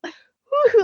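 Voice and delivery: high excited woo-hoo; excited cat voice. Retold or imitated. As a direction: squeal it like a gleeful cat. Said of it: "mannerisms he does as Princess Donut".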